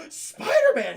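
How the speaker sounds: loud, high cry of the name; shocked exclamation. Acted. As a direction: exclaim it in mock horror. Doctor shocked by his aggression.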